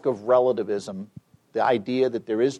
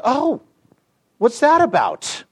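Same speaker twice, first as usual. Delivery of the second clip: high-pitched, louder "oh" of nosy interest; curious seatmate; 11 semitones higher, 6 dB louder, slower than usual. Retold or imitated. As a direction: imitate a nosy passenger pouncing on it. Acting nosy seatmate's curious question.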